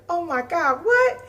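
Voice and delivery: high shocked gasp of words; stunned, disbelieving. Retold or imitated. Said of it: "And I was looking like".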